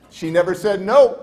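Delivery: usual speaking voice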